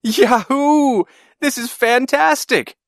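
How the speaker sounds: very high, drawn-out joyful whoop; excited yell. A narrator's reading. Boy riding above the clouds, yelling over engine noise. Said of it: "I yelled".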